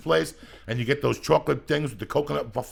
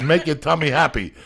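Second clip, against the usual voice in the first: jingle motto; 4 semitones higher, 8 dB louder than usual. Retold or imitated. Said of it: "And their mottos was".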